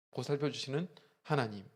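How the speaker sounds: normal talking voice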